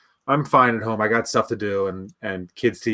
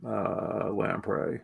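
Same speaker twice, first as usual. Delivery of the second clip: Belichick mumble; 4 semitones lower, 11 dB quieter, much slower than usual. Shown as a demonstration. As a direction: say lamprey low and mumbled, like Belichick. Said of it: "How would he say lamprey?".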